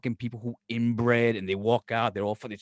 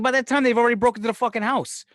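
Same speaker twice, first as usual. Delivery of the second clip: much higher and louder, fed-up rant at the doorbell; exasperated outburst; 10 semitones higher, 6 dB louder than usual. Retold or imitated. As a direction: blurt it out in disbelief, like the thief's already inside. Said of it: "I'm like".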